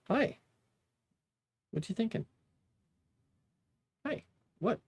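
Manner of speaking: high cooing pet voice; pet talk. Real, not acted. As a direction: coo up at the cat in a high voice. Cooing at the cat.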